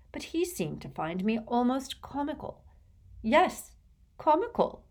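climbing high and indignant; rising hysterically. A narrator's reading. Trelawney stung at seeming comical, rather hysterical. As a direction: let the voice rise into mild hysteria. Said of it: "Her voice rose rather hysterically".

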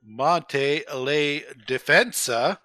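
slow, loud Italian accent on Monte alle Difensa. Shown as a demonstration. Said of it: "That's my Italian accent".

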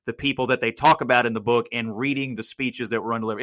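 usual speaking voice

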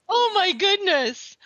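high, slow mock astonishment; overwhelmed gasp. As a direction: draw it out like you're stunned. Acted reaction, high slow.

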